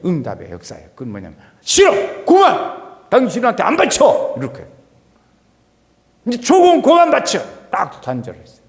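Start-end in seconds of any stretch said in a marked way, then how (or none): 1.6-4.3 loud, high, angry refusal; defiant shout. Acted. King Hezekiah refusing tribute to the Assyrian king, furious shout. 6.2-7.4 loud, high, angry refusal; defiant shout. Acted. King Hezekiah refusing tribute to the Assyrian king, furious shout.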